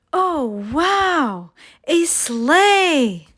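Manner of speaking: put-on a child spotting a treasure; little girl's excited wow; delighted girl. Little girl finds a sleigh.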